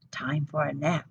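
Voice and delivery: soft sleepy whisper; whispered thought. Acted. Aluki getting sleepy by the lamp, whispering. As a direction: breathe it to yourself. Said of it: "she thought".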